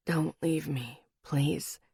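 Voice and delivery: low, soft pleading; pleading man. A narrator's reading. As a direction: beg quietly, half asleep. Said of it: "His words almost a plea".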